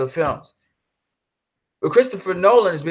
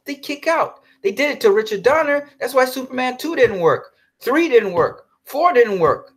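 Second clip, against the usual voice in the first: angry rant; 8 semitones higher than usual. Real, not acted. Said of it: "I am just very angry".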